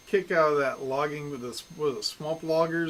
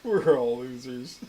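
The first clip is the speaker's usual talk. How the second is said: laughing; 7 semitones higher, 8 dB louder, slower than usual. Real, not acted. Giggly loopy repeated line.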